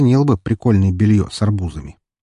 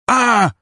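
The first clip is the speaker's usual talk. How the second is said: high sharp shriek; sudden squeal. Acted. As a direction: squeal in shock. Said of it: "она внезапно взвизгнула".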